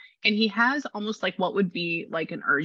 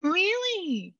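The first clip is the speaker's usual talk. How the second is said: stunned; 13 semitones higher, slower than usual. Real, not acted. Shocked by scan news.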